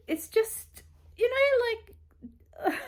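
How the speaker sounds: high squeaky cracking voice. Real, not acted. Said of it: "my voice is going so squeaky there".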